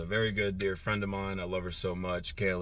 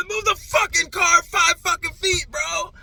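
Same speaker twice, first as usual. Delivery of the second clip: much higher and louder, full-throated yell; retold furious yell; 11 dB louder, slower than usual. Driver yelling at man who won't move his car, furious yell. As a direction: scream the order at him, fed up. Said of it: "and of course I'm yelling".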